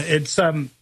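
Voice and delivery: usual speaking voice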